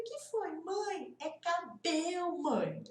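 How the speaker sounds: put-on high, slow, drawn-out child's voice; little daughter correcting. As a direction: correct mum like an amused little girl. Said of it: "Ela me corrigindo". (voz de criança corrigindo a mãe, aguda e arrastada)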